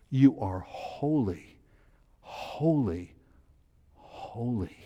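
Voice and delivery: soft, slow, fading into near silence; hushed reverent praise. Shown as a demonstration. Teacher showing the class heartfelt worship, hushed and slow. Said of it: "as a matter of emphasis".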